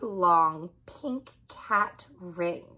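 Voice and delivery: usual speaking voice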